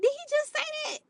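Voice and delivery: high, stunned disbelief; squeaky shocked inner voice. Retold or imitated. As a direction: gasp it out, high and incredulous. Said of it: "I'm like".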